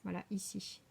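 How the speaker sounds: ordinary delivery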